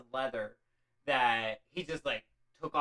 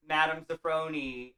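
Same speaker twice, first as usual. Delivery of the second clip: ordinary talk, nothing marked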